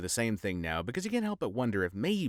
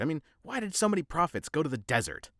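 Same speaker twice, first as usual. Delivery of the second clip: ordinary delivery, nothing marked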